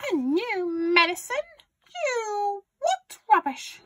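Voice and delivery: very high, scornful granny yell; yelling grandma. A narrator's reading. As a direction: scoff loudly as Grandma. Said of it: "she yelled".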